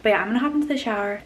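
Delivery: ordinary talk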